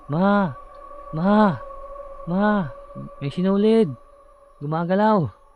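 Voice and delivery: high, loud terrified yells to mother; panicked shouting. Retold or imitated. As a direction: wake your parents, something is moving toward you. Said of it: "Ginigising ko ngayon yung nanay ko".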